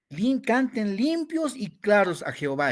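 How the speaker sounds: high, loud preaching of "limpios"; emphatic, high. Shown as a demonstration. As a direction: lift the voice to show clear praise. Predicador muestra cantar limpio. (voz alta y enfática de predicador)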